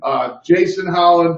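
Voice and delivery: normal talking voice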